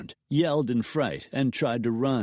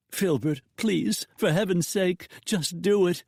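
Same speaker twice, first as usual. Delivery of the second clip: higher, frightened begging; terrified plea; 9 semitones higher than usual. A narrator's reading. Wife begging her husband to pay, terrified and high. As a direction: plead with him in fear. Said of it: "Her voice quavered with terror".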